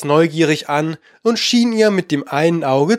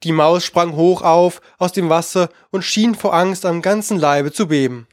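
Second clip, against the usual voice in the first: ordinary delivery, nothing marked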